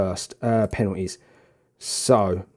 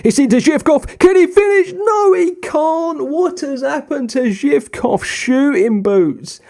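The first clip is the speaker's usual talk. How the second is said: high, fast rush of live commentary over the chance; excited commentary; 13 semitones higher than usual. Real, not acted. Live match commentary, near miss.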